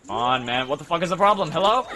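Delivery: frustrated outburst. Real, not acted. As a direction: vent real frustration, rising to an exasperated Hello. Frustrated by a stalled game.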